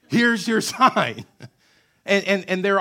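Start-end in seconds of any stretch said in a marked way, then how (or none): 0.1-1.2 higher, louder, slow sarcastic drawl; sarcastic comeback, spelled out slowly; sarcastic punchline. Retold or imitated. Punchline to demanding Pharisees.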